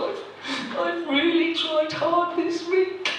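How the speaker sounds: high, wobbly voice of a weeping dieter; tearful woman. Retold or imitated. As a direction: whimper it out like she's about to cry. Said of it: "this woman in front of me started crying".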